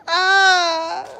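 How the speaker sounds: high mock shriek of a girl; imitated scream. Imitating the girl screaming in the alley, a high shriek.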